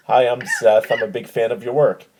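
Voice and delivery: ordinary delivery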